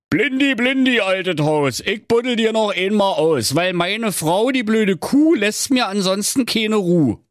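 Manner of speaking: high, sing-song calling; high-pitched pleading call in Berlin dialect; husband summoning Blindi. Acted. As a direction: chant the rhyme out to an old pal.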